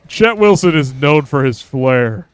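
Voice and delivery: a lightly put-on smooth showman voice; Chet Wilson voice. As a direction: play a self-satisfied charmer with flair. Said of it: "when Chet Wilson says things".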